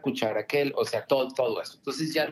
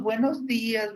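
sweet mom; 11 semitones higher than usual. Retold or imitated. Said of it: "pasa muy linda la primera ronda".

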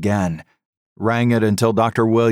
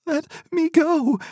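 very high, sobbing plea; mother sobs at husband to let her go, sobbing. A narrator's reading. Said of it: "she sobbed".

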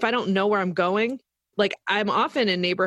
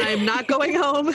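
slow mock protest; 6 semitones higher, slower than usual. Talking back to the navigation app.

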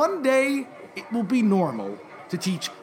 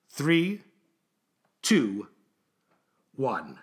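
slow count, sinking lower; calming countdown; 6 semitones lower, much slower than usual. Acted. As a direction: count down with long pauses, dropping in pitch. Slow countdown after the story.

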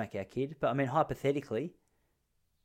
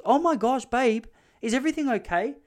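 alarmed wife; 9 semitones higher, 4 dB louder than usual. Retold or imitated. Wife alarmed in retold story.

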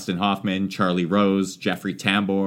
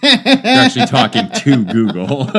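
louder, higher repeat over the speaker; repeating to voice assistant; 5 semitones higher, 6 dB louder than usual. Real, not acted. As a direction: say it again loud, over the device.

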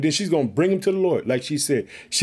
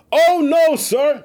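high, louder pleading cry; pleading woman; 9 semitones higher, 5 dB louder, slower than usual. Acted. Preacher acting Hannah answering the priest, high pleading.

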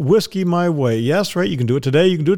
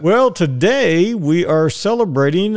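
normal talking voice

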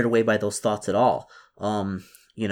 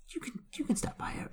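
whisper; 11 dB quieter than usual. Acted. Whispered permission.

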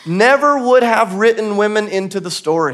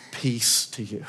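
tender peace; 9 semitones lower, 12 dB quieter, slower than usual. Acted. Voicing Jesus' first words.

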